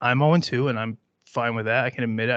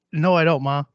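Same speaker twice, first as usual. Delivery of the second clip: ordinary delivery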